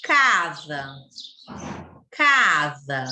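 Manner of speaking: "Casa" with a long, strong CA, falling away on sa; teacher demonstrating the stressed CA in "Casa"; slow, stressed syllable. Shown as a demonstration. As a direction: say "Casa" slowly, leaning on the first syllable. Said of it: "Fala devagar".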